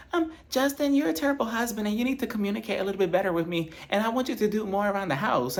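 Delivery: high, fast nagging complaint; mocking Whitney. Vlogger mimics Whitney scolding Justin at dinner, high and fast. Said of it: "Whitney already tells him".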